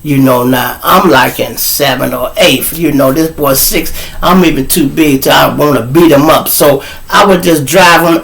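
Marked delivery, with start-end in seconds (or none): none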